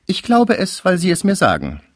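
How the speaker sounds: deutlich höhere Mädchenstimme; hoch ansetzen, wie ein artiges Fräulein; junge Frau. A narrator's reading. Junge Cécile antwortet dem Verehrer. (hohe Stimme einer jungen Frau)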